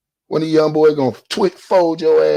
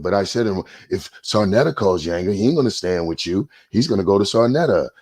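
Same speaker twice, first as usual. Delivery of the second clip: lower, mocking voice of the other guy quoting himself; mocking imitation; 8 semitones lower than usual. Mocking a rival YouTuber by quoting him, lower and sneering. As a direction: sneer his words back at him.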